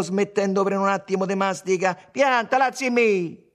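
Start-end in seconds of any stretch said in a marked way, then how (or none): 2.1-3.5 high, loud, drawn-out telling-off; acted ferocity with laughter held back; fierce, half amused. A narrator's reading. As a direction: order him to quit, fierce but close to laughing. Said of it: "con però la bocca che un po' gli scappava da ridere, feroce".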